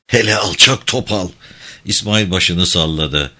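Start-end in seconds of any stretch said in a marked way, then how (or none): 0.1-1.3 hissed through clenched teeth; angry whisper through the teeth; whisper yelling. A narrator's reading. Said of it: "Dişlerinin arasından fısıldadı". (dişlerinin arasından fısıltıyla)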